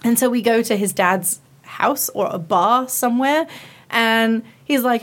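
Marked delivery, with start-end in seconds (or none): none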